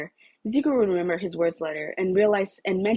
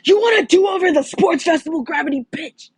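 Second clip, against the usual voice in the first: higher, loud, snarling taunt; aggressive shout; 11 semitones higher, 8 dB louder than usual. Acted. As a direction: shout him down, furious. Bakugo rounding on Uraraka.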